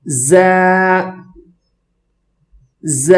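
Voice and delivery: ز as "zaa" with a strong buzz; firm articulation. Shown as a demonstration. Teacher models for students, firm buzzing ز. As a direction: press firmly into the z of ز, then open it out. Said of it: "سختی کے ساتھ پڑھیں گے".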